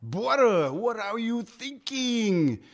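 put-on a fond, light Haitian accent on a slow question. Haitian pastor friend quizzing people, in a lightly imitated Haitian accent. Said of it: "he had this funny speech and accent".

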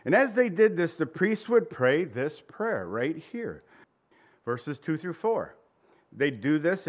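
usual speaking voice

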